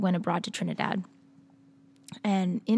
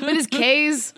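put-on Boston accent; 7 semitones higher, 7 dB louder, much slower than usual. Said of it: "my Boston accent".

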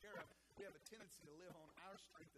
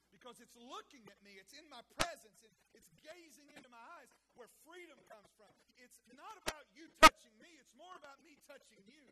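higher, loud bursts of preaching; building preaching; 8 semitones higher, 22 dB louder than usual. Acted. Pastor voicing Jesus to Thomas, building preaching. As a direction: press it home with rising force.

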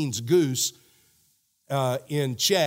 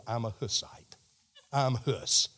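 normal talking voice

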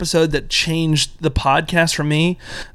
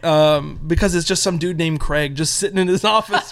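normal talking voice

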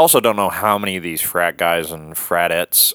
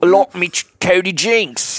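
very high, silly British caricature; mock British accent; 11 semitones higher, slower than usual. Bandmate joining the British bit about [name], high mock British. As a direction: squeal it as a cartoon Brit. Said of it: "starts in on the British accent".

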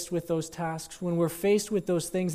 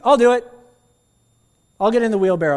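put-on higher, much louder eager boy; little boy volunteering; 7 semitones higher, 12 dB louder, faster than usual. Little boy volunteers to the daredevil, loud eager. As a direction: pipe up bravely.

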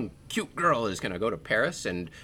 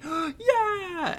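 much higher shrill yell of delight; mock cheer. Emily cheering the game on TV, high squeal. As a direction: squeal it like a giddy fan. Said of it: "And Emily's like".

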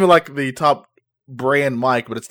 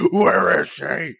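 slow, drawn-out superhero growl, pitched up; louder, hammed-up Batman demand; 4 semitones higher, 5 dB louder, much slower than usual. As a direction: do an exaggerated Batman interrogation voice.

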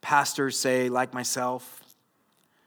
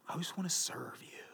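soft whispered pleading, put on; mock devoted whisper; 16 dB quieter than usual. Husband answering his wife's scolding, mock devoted whisper.

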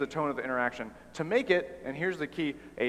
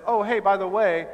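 breezy, offhand aside, louder and higher; Jesus voiced as a chummy buddy; casual Jesus; 6 semitones higher, 6 dB louder than usual. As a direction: toss it off like a friendly afterthought.

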